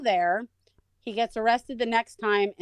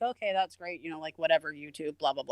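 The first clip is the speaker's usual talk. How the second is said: uninterested; 4 semitones lower, 6 dB quieter than usual. Retold or imitated. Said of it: "I was, like, uninterested".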